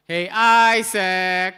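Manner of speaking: slow, high, loud "Isaac" hollered out; loud rally call. Shown as a demonstration. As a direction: cry it out so they answer back.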